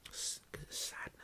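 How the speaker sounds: breathy unvoiced aside; whisper. Real, not acted. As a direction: hiss it under your breath.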